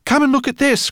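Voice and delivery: high, loud call; the professor calls them over excitedly; cried out. A narrator's reading. The professor calling everyone over to the shrine, loud. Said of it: "cried the professor".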